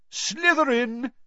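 high, drawn-out call; shouted verdict. A narrator's reading. Sorting hat announcing a house, high slow shout. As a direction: announce it slowly to the hall.

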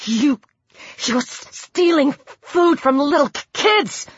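halting, frightened accusation with pitch climbing; nervous, broken stammer. A narrator's reading. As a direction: stutter on the hard consonants, scared but accusing. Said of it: "she stammered".

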